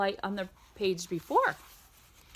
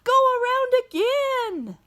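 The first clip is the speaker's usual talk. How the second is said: squeaky, louder little dog; high dog voice; 4 dB louder than usual. Acted. Reading to a child, the dog calls out high. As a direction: call it out high like a tiny dog. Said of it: "This dog says".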